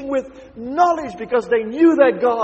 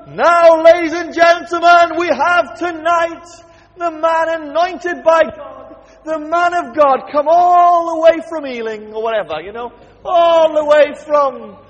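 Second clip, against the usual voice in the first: loud, higher, shouted showman introduction; mock hype announcer; 5 semitones higher, 7 dB louder than usual. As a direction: hype up the man of God like a ringmaster. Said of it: "We don't quite say it like that".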